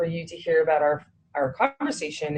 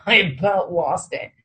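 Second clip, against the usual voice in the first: usual speaking voice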